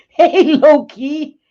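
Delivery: high, loud, laughing hello to the pet; delighted squeal of a greeting; excited laughing greeting. Real, not acted. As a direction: burst out happily at the dog.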